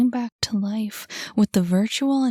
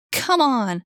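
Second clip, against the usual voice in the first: cried out; 4 semitones higher, 3 dB louder than usual. A narrator's reading. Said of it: "cried Annie".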